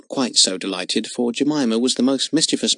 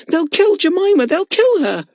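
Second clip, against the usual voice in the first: high, louder terrified lament; wailing; 6 dB louder than usual. A narrator's reading. Girl wailing that the tigers will kill her monkey. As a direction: cry it out in horror. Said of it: "wailed Lotta".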